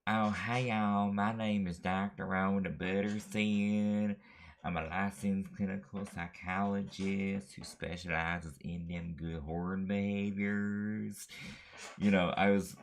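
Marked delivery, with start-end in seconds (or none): 0.1-11.3 higher, louder Southern drawl, hammed-up; a mock Southern therapist introducing herself in a Southern accent. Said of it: "that Southern accent".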